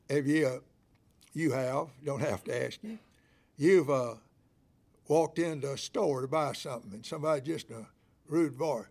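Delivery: ordinary talk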